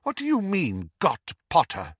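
high start falling to a firm finish; mildly alarmed, stern query; sharp questioning. A narrator's reading. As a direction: demand an explanation pointedly. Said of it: "said Professor McGonagall sharply".

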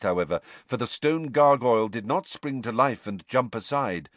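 usual speaking voice

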